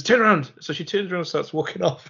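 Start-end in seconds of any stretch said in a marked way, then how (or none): 0.0-0.5 barked order. Retold or imitated. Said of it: "He goes".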